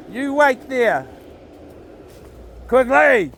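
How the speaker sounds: high, loud commands to the dog; shouting at the dog. Real, not acted. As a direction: order him to stay put. Dog runs off again.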